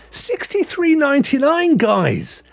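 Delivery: high, loud excited announcement. Real, not acted. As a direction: blurt out the bargain price with real excitement. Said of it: "Sorry, I'm getting all excited".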